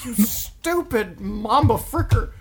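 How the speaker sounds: slow, high goofy insult; silly mock insult.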